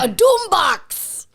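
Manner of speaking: high, loud, drawn-out yell; hammed-up booming cry; playful shout. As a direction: shout it back with long vowels. Joining the loud doom riff.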